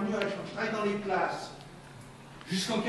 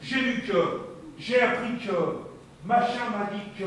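louder, higher, measured sentence openers; firm demonstration; 4 semitones higher, 6 dB louder than usual. Shown as a demonstration. Speaker showing parents how to name facts, firm and emphatic. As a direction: set out each opener firmly, hanging on "que". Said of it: "nommer des faits".

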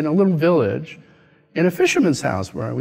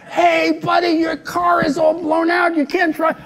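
yelling stranger; 13 semitones higher than usual. Retold or imitated. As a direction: holler at him that the car is wrecked. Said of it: "people were yelling at me".

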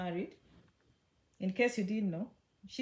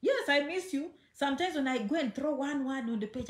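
normal talking voice

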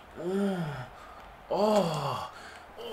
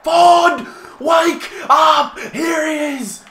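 excited shout; 8 semitones higher, 8 dB louder, much slower than usual. Real, not acted. Cheering a player in the game.

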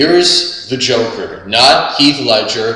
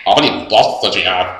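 Beavis voice; 4 semitones lower, much faster than usual. Retold or imitated. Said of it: "Beavis and Butthead".

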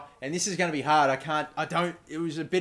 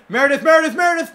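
loud, much higher repeated name; calling out; 12 semitones higher, 10 dB louder, faster than usual. Real, not acted. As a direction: urge the player on by name. Calling out to player on screen.

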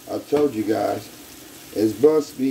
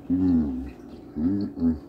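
normal talking voice

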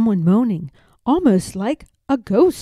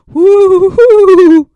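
high, loud ghostly hooting; ghost's hoot; 9 semitones higher, 14 dB louder than usual. A narrator's reading. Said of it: "he cried".

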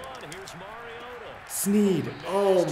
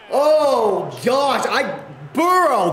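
high, loud, stretched cry at a fumble; shocked yell; 8 semitones higher, 5 dB louder, slower than usual. Real, not acted. Fumble in his video game.

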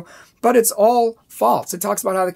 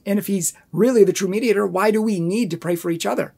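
ordinary delivery

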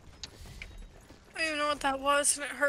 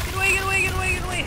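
loud, high panicked yelling; panicked shouting; 7 semitones higher, 4 dB louder, much faster than usual. Real, not acted. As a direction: yell at the mummy to back off. Chased by mummy in game.

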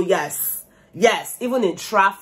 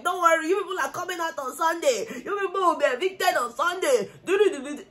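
mock taunting; 7 semitones higher, 3 dB quieter than usual. Mimics people's taunts about eviction.

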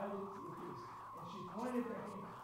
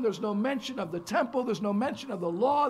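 louder, building list of no mention; preaching emphasis; 15 dB louder than usual. Acted. Preacher hammers what the book of Esther leaves out. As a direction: drive each there's no mention home.

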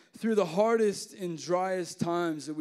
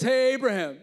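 God calling out; 6 semitones higher, 8 dB louder than usual. Retold or imitated. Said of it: "So God says".

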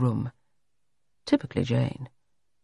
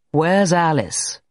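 abrupt question, a little higher, drawn out; abrupt demand; 3 semitones higher, 3 dB louder, slower than usual. A narrator's reading. Everard demands the maid at the door, abrupt. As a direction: demand it without a greeting. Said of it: "without any attempt at greeting".

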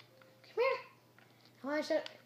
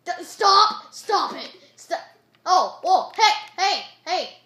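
high, very loud bursts; alarmed yelling; 9 semitones higher, 17 dB louder, slower than usual. Real, not acted. Gamer to attacking player, alarmed yelling. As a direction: yell in short urgent bursts.

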